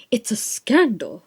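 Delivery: hammed-up outrage landing on "scandal"; emphatic. A narrator's reading. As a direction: punch "scandal" like an affronted official. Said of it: "said em-, em-, emphatically".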